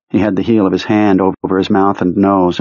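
usual speaking voice

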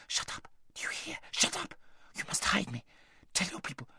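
fierce, urgent whispering; furious whisper. A narrator's reading. Aravis silencing her friend in the litter, furious whisper. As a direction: whisper furiously into someone's ear. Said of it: "whispered furiously in her ear".

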